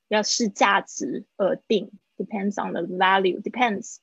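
usual speaking voice

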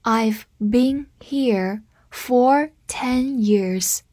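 very slow, word by word; slow read-through. Shown as a demonstration. Teacher modelling "for" with a time span, slow read-through. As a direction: say it slowly with clear gaps between words. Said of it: "我们慢读一遍".

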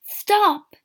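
high, loud command; sharp, alarmed shout; urgent cry. A narrator's reading. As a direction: shout to halt her. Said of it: "he cried".

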